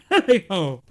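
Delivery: loud jolly cry. A narrator's reading. Fezziwig cries out as he skips from the desk. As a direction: whoop it out. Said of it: "cried Fezziwig".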